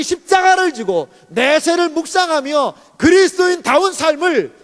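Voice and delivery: loud, high, insistent sermon delivery; full-force preaching. Real, not acted. Pastor driving home to the congregation that reconciliation is given, preaching forcefully. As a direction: preach it out in sustained, emphatic phrases.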